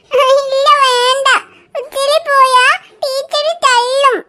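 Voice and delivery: put-on small weepy child voice, drawn out; tearful little girl. Little Riya whimpering to her aunt about school. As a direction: whine it like a toddler near tears.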